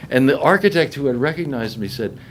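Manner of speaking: ordinary delivery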